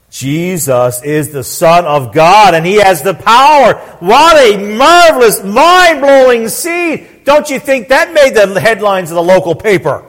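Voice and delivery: stretched, emphatic pulpit proclamation; slow, weighty words swelling with wonder; impassioned preaching. Real, not acted. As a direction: marvel aloud to the congregation. Preaches with force.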